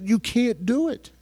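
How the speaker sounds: normal talking voice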